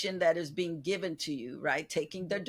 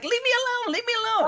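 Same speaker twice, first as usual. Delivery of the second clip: high-pitched, mock-pestered whine; imitating a fed-up child, pitched way up; whiny kid protest; 3 dB louder, much faster than usual. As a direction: whine it like a kid fending off a parent. Voicing an annoyed child to parent.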